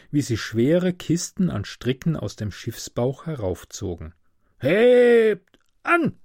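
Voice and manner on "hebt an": high, loud sailors' hauling cry; shouted work call. A narrator's reading. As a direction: shout it long across the deck. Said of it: "schrien sie jedes Mal". (lauter, gedehnter Matrosenruf)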